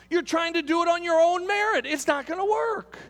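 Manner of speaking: high, ringing pulpit warning; impassioned preaching. Real, not acted. Preacher warning people against relying on their own merit, impassioned. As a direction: press it home from the pulpit, pitched high.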